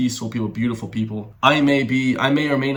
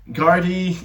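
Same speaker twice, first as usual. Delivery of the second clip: louder, higher mock Eastern European accent; put-on Eastern European accent; 7 semitones higher, 5 dB louder than usual. Host showing off for viewers, Eastern European accent. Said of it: "you guys like my Eastern European accent".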